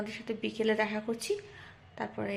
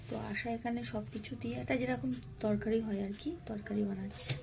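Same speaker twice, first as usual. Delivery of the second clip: softer, hushed narration so the sick mother keeps sleeping; speaking softly; 8 dB quieter than usual. Real, not acted. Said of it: "ওই জন্য আমরা আস্তে আস্তে কথা বলছি".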